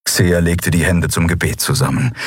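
ordinary delivery

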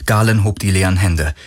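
ordinary delivery, nothing marked

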